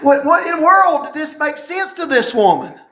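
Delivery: ordinary delivery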